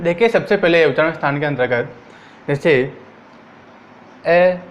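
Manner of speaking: ordinary delivery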